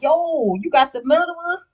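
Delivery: the son, caught off guard, calls out in shock. Retold or imitated. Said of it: "Ellis like".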